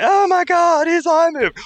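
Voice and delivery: very high shrieky panicked woman; mock hysterical woman. Imitating hysterical woman, high far.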